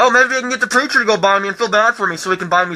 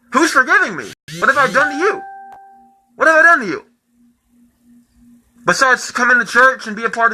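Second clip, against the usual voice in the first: high, pressing questions; angry rant; 5 semitones higher than usual. Real, not acted. Shouting back at the church that forgave him, angry. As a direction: demand answers, rising anger. Rant against being forgiven.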